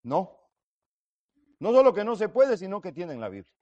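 loud, high no, then settling; Abraham's firm reply to the rich man; firm refusal. Acted. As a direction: answer no with finality. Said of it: "qué le fue respondido".

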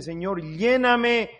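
loud, slow, emphatic model prayer; fervent prayer. Acted. Preacher showing congregation how to ask for the Spirit, fervent prayer. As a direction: plead with God at full voice. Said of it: "Tenemos que orar y decirle".

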